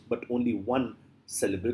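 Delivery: usual speaking voice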